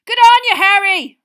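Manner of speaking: loud, high roar. A narrator's reading. Seamus roaring congratulations to Harry, loud roar. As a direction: roar it over the crowd. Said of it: "roared Seamus Finnegan".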